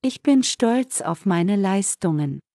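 usual speaking voice

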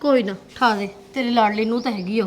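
low, soft bitter grumble under the breath; jealous muttered aside. Acted. Daughter-in-law grumbling at her mother-in-law, low soft muttering.

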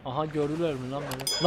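usual speaking voice

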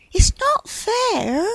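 very high, slow, sulky whine; high-pitched, hard-done-by sulk. A narrator's reading. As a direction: drag out the complaint in a high voice. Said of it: "sulked Cloud".

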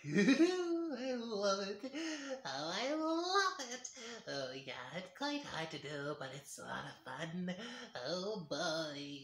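high, slow gleeful mad scientist; giddy mad scientist cackle; mad scientist laugh. Shown as a demonstration. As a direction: savor it like a giddy madman. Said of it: "his laugh is more like".

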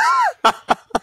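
a loud high cackle; high laugh. Real, not acted. Laughing loudly at the hallucinogenic drugs punchline. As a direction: cackle at your own punchline.

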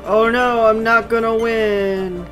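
normal talking voice

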